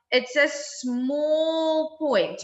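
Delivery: slow, drawn-out "small point"; stress on small. Shown as a demonstration. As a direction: stretch each word of "It's a small point". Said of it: "emphasizing small".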